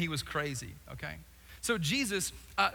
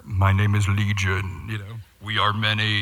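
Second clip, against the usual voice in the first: demonic growl; 11 semitones lower, 10 dB louder than usual. Retold or imitated. Preacher voicing the demon Legion, deep booming growl. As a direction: boom it out like a possessed man. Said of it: "I think he probably said".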